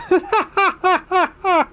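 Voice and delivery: a sudden high goblin giggle; goblin cackle. Acted. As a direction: burst out in a creature's cackle. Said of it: "What was that?".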